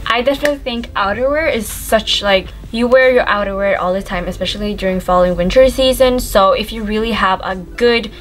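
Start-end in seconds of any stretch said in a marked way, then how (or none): none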